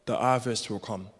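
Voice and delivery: ordinary talk, nothing marked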